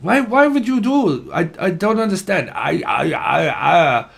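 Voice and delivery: high, baffled mock Russian voice; mock Russian accent. Reader retells a Russian Facebook video, mock Russian accent. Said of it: "it was Russian".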